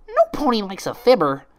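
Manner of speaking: high, loud scolding squeak; reproachful Pinkie. Acted. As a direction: chide her like a child. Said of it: "said Pinkie Pie with a reproachful look".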